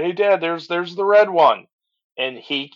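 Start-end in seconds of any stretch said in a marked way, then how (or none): 0.0-1.7 higher-pitched mild mock shout; retold shout. Son spots the shuttle and calls to dad, retold mild shout. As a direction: imitate a kid lightly calling out to dad. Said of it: "looked over and shouted".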